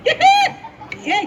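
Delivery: very high, sudden snap of "ए!"; piercing high scold; sharp yell. Acted. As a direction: stop him dead with one high shout. Snapping at the kid.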